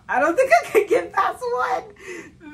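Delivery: slow, high, loud laughing cry; high laughing wail. Real, not acted. Vlogger dreading the dry biscuit challenge, high laughing wail.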